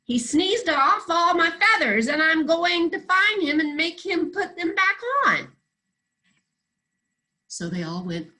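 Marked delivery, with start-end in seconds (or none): 0.0-5.6 angry goose. Acted.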